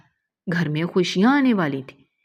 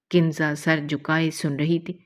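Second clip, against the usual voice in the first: ordinary delivery, nothing marked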